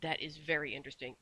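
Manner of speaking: plain reading voice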